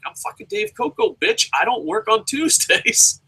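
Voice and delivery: cocky diva. Pretend. Dave Coco telling his bosses he's off Tuesdays, cocky and high. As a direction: announce it like a prima donna. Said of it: "I'm like".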